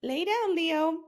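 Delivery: high-pitched pet command; talking to the cat. Real, not acted.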